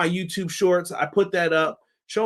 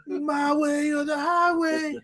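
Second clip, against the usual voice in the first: mock catchphrase; 10 semitones higher, slower than usual. Repeats the catchphrase in a drawl.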